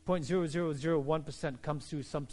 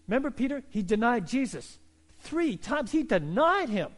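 ordinary talk

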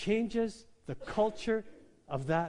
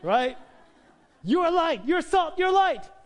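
much higher and louder, hammering each word; emphatic shout; 9 semitones higher, 9 dB louder than usual. Real, not acted. Preacher to church, shouting for emphasis. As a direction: shout it out to the congregation. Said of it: "I'm just doing it for emphasis".

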